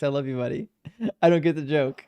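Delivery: ordinary delivery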